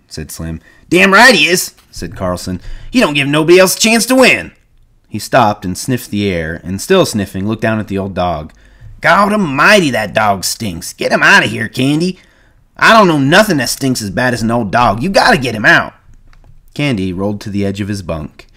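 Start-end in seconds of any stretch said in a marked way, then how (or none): 0.9-4.5 higher, louder rough ranch hand; a blunt bunkhouse voice; Carlson. A narrator's reading. Carlson praising the horseshoe pitcher, loud. 9.0-15.9 higher, louder disgusted complaint; disgusted Carlson. A narrator's reading. Carlson demanding Candy remove the dog, disgusted.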